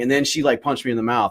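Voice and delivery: normal talking voice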